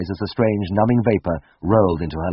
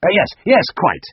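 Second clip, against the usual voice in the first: snapped; 10 semitones higher, 4 dB louder than usual. Acted. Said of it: "Siegfried snapped".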